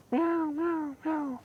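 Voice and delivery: soft, high imitated kitten mewing; contented kitten meow. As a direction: mew softly like a comfortable kitten. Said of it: "cub is feeling very comfortable".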